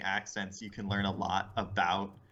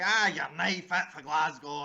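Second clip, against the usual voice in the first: higher-pitched, R-rolling Glasgow Scots; thick Glaswegian outburst; Glasgow accent; 6 semitones higher than usual. Shown as a demonstration. Comic contrasting Glasgow with Edinburgh, broad Glasgow accent. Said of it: "if you go to Glasgow".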